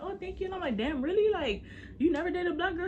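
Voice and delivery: high-pitched, pleasantly astonished; retold disbelief, pitched well up; flattered surprise. She answers his compliment on her lips, flattered surprise.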